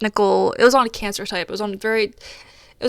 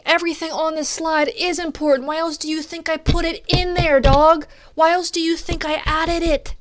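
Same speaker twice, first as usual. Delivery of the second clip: exasperated inner rant; 6 semitones higher, 4 dB louder than usual. Retold or imitated.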